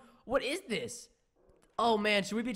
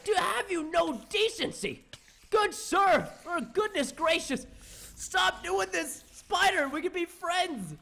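mock outraged gentleman; 9 semitones higher, 3 dB louder, slower than usual. Pleading with attacking spider.

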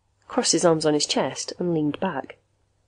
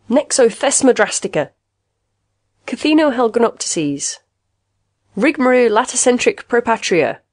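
higher, emphatic nonsense words; shot back; 5 semitones higher, 5 dB louder than usual. A narrator's reading. Patient answers word test with proud nonsense, shot back. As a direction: rattle off invented words proudly. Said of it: "Caswell shot back".

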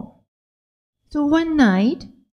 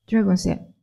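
ordinary delivery

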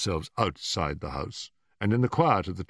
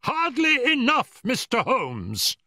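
shrieking, drawn-out fury; very high, slow, furious old man's scream; high screaming cry; 5 dB louder, slower than usual. A narrator's reading. Old man confronts Holmes over the intrusion, screaming. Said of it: "cried in a high, screaming voice".

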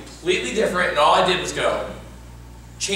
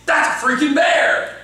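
high, drawn-out acted shout; committed alarm; 8 semitones higher, slower than usual. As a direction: shout the discovery with full commitment. Demonstrates committed improv choice.

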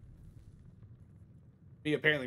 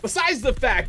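louder and higher, fed up; exasperated outburst; 8 semitones higher, 7 dB louder than usual. Real, not acted. Ranting to chat about his mom, frustrated outburst. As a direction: blurt it out in frustration.

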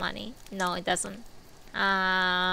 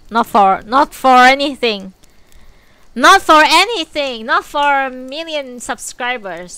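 emphatic refusal; 3 semitones higher, 9 dB louder than usual. Real, not acted.

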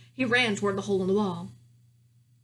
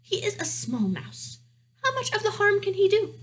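lightly voiced mouse mother, rising much higher; dismissive mouse wife; 11 semitones higher, 4 dB louder than usual. Mouse wife defends the small mouse to her husband, higher voice. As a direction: play the unconcerned mouse wife, lifting the pitch.

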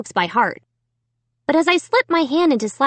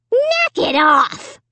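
slow, higher, harsh puppet voice; harsh dummy; 6 semitones higher, much slower than usual. A narrator's reading. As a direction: draw out a harsh retort as the dummy. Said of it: "came the harsh reply".

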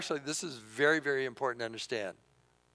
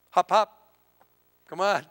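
higher, much louder, pushy hurry-up call to God; impatient demanding attitude; 4 semitones higher, 8 dB louder than usual. Retold or imitated. Pastor mocking his own prayers, loud impatient demand. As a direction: snap your fingers at God, hammed-up impatience. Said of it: "It's totally my attitude".

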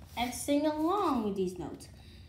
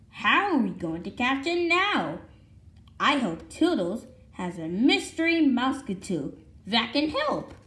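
put-on reading to children; 6 dB louder than usual. Reading picture book to children.

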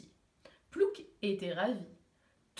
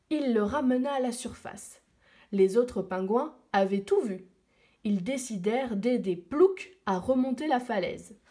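ordinary delivery, nothing marked

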